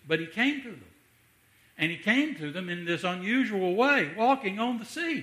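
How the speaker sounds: loud ringing sermon proclamation; preacher's swelling declaration; full-force preaching. Acted. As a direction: proclaim it to the back pew. Preaching peak.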